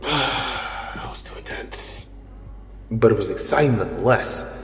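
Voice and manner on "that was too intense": slow, breathy relieved whisper. Acted. Hero after nearly being caught, slow relieved whisper. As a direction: let out the tension softly.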